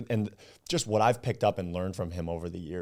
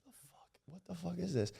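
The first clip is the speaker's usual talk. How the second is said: fast, high, hushed disbelief; baffled inner thought; 11 semitones higher, 11 dB quieter, faster than usual. Retold or imitated. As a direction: mutter it to yourself confused. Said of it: "I'm thinking to myself".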